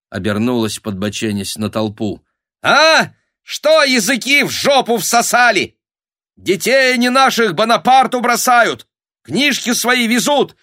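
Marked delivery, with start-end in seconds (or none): 2.6-10.6 high, loud shrieking harangue; furious rabble-rousing. Acted. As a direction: goad the crowd with angry shrieks. Баба натравливает толпу криком. (злобный бабий крик)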